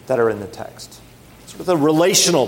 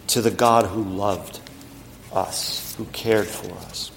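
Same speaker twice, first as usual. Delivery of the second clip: dropped to a near whisper; 7 semitones lower, 7 dB quieter than usual. Real, not acted. Soft close of thought.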